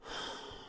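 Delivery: soft, demonstrative huff; passive-aggressive breathy sigh; exasperated sigh. Shown as a demonstration. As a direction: sigh in silent British disapproval. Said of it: "they do the eye roll".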